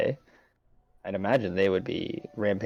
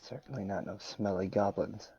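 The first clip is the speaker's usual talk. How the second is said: soft muttered aside; under the breath; 10 dB quieter than usual. Acted. Adventurer's private aside to Hawk, muttered under the breath. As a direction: say it quietly, for one companion only. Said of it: "still under my breath to Hawk".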